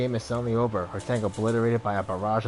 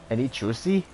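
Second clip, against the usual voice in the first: ordinary talk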